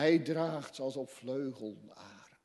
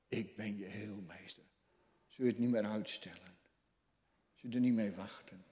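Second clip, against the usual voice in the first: ordinary talk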